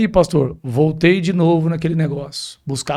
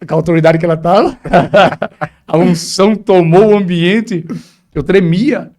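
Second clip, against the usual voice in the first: emotional laughing; 7 dB louder than usual. Real, not acted. Reliving the anointing, laughing, trembling.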